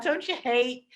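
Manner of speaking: tipsy playboy lightly imitated; a hint of Dudley Moore's Arthur; Arthur impression. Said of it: "doing my Arthur impression".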